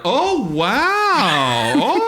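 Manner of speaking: high, loud fake wonder; mock amazement. Audience pretending to be amazed, hammed-up high wow. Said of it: "like we were all gonna be like".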